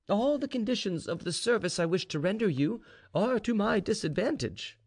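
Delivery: high-voiced informer making his case; scheming slave informer. A narrator's reading. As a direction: bargain respectfully but insistently with your master. Gregory the slave bargains with general.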